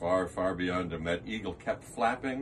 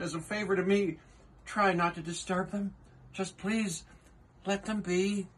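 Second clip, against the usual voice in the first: earnest, higher elephant voice; Horton the elephant; 6 semitones higher than usual. Acted. Horton pleading with the kangaroo, earnest higher voice. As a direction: plead gently as the kind elephant.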